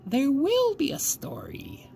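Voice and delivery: high, slow, breathy whisper; eerie whisper. A narrator's reading. Grandmother at bedtime promising a story, ominous whisper.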